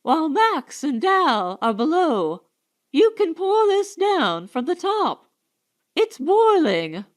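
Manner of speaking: high, excited, eager to pour the water; frantic urging. Acted. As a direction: press him urgently to act. Urging him to pour boiling water.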